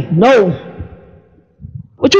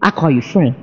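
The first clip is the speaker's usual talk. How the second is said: tender God; 6 semitones lower, 4 dB quieter than usual. Retold or imitated. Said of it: "God looked back and said".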